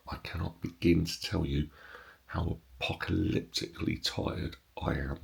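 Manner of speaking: soft unvoiced whisper; hushed tones. Real, not acted. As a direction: whisper it so nobody wakes. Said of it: "I'm saying this in slightly hushed tones".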